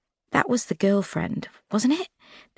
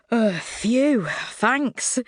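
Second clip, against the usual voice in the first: winded; 6 semitones higher, much slower than usual. Acted. Winded by sudden hug.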